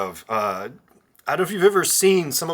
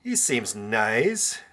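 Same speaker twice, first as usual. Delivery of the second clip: slow deadpan read; 3 semitones lower, much slower than usual. Retold or imitated. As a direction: slowly read out, sarcastic. Reading a text deadpan.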